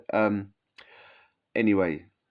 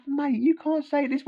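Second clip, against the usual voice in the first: much higher, slow pleading protest; mock outraged objection to the officer; incredulous protest; 13 semitones higher, slower than usual. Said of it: "we was like".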